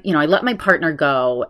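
normal talking voice

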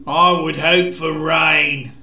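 drawn-out threat with a sneer. A narrator's reading. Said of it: "he sneered".

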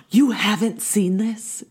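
slow, high imitation of shocked friends; mock disbelief. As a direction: act scandalised after a gasp. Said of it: "everyone's like".

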